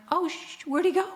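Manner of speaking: high, sudden surprised outburst; startled surprise. Retold or imitated. Finding the bee gone from beside her foot, surprised. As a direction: catch your breath and ask where he went. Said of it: "I'm like".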